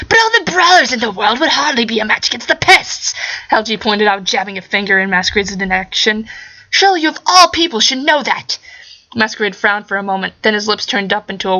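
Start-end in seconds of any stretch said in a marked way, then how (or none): 0.1-3.1 high, strained mad-scientist voice; scheming scientist squeezed high in the throat; Hal G voice. Acted. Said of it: "doing Hal G's voice hurts". 6.7-8.6 high, strained mad-scientist voice; scheming scientist squeezed high in the throat; Hal G voice. Acted. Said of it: "doing Hal G's voice hurts".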